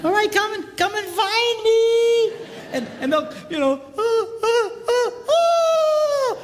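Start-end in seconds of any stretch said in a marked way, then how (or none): 0.0-2.3 put-on high, loud sing-out to little kids; playful calling out. Playing hide and seek with grandsons. 4.0-6.4 high, loud "ah" cries, last one drawn out; mock high cries. Acting out hide and seek.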